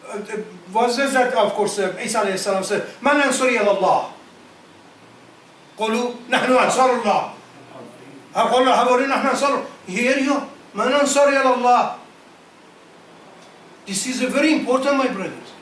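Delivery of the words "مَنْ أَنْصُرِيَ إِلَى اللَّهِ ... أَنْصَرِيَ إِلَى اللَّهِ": rapid chanted Arabic verse; تلاوة سريعة; Quran recitation. Real, not acted. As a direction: recite it quickly in one breath. Quoting Isa in Arabic.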